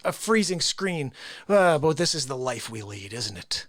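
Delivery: ordinary talk, nothing marked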